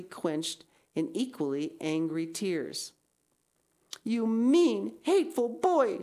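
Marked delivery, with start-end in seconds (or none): 4.0-6.0 put-on loud, high, furious girl's cry; girl lashing out at the teasing boy; passionate outburst. A narrator's reading. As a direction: hurl it at him in a rage. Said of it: "she exclaimed passionately".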